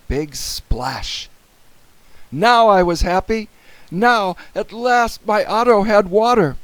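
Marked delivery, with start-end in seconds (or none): 2.3-6.6 high, loud, joyful; happy relief. A narrator's reading. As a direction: announce it with delighted relief. Boy narrator relieved and happy.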